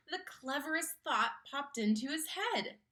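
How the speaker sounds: ordinary delivery, nothing marked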